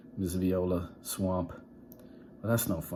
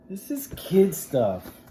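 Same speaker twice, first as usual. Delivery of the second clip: higher, louder, slow scoffing; scornful kids; scornful class; 10 semitones higher, 5 dB louder, slower than usual. Acted. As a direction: kids unimpressed by shadow puppets. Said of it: "said the class".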